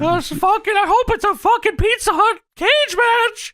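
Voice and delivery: very high comic wail; hammed-up squealing voice; put-on wailing character. Joke in the Paul Bearer voice.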